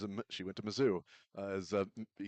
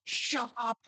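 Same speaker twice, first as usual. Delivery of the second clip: much higher mock yell; a playful mock yell, much higher; retold shout; 14 semitones higher than usual. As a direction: snap it back in a high jokey voice. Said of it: "And I said".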